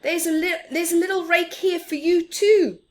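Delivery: high, loud offer called across; calling out. Acted. Big Mouse offering Little Mouse a rake, called out loud. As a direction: call it out cheerfully.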